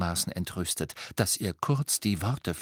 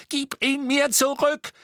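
high, drawn-out furious demand; frantic, enraged screech; losing all control; 4 dB louder than usual. A narrator's reading. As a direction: shriek the demand. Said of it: "derart die Beherrschung verlieren sehen".

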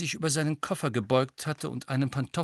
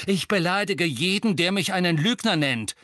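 angry retort; 7 semitones higher, 5 dB louder than usual. A narrator's reading. Acted quarrel, Harry retorts to Seamus.

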